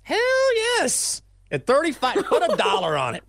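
squeaky pubescent voice crack; voice cracking high. Pretend. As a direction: jump way up like a voice breaking mid-puberty. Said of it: "You just hit puberty".

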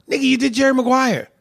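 high-pitched, exasperated protest; incredulous retort. Acted. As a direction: throw it back in loud disbelief. Mock retort to an absent director.

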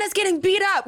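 high-pitched mock alarm; alerting call. Bystander alerting friends that Tana is being attacked, high-pitched alarm. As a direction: call it out high, as urgent news. Retold alarm from someone nearby.

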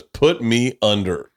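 drawn-out, slow order; slow deadpan quote. Retold or imitated. Husband retelling Whitney's answer to the doctor, slow and dry. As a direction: say it very slowly, dry. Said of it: "she goes".

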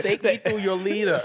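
put-on slow alien voice with a Dominican accent; a playful Dominican-accented alien, drawn out. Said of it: "Why does Stitch sound like that now?".

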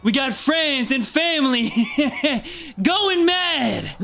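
slow, high rallying shout from a gnome; raging gnome rebel. Acted. Gnome on the crates shouting at the crowd, angry rallying cry. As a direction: rouse the crowd like an angry little rebel leader. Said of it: "you hear him go".

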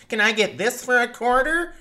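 high, put-on bargain hunter voice; mock cheapskate asking a price; haggler voice. Mimicking garage sale haggler.

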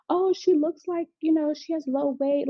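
imitating fussing staff. Staff wanting to weigh the newborn, mock fussing. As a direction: put on a fussy nurse's voice.

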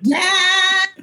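high, loud held yes; hammed-up ghostly yes; imitating ghost voice. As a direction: wail it out high and long. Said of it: "it's saying".

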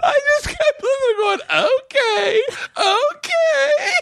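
high, strained voice, half laughing, half crying; laughing to tears. Real, not acted. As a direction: push the words through helpless laughter. Laughing to tears while retelling.

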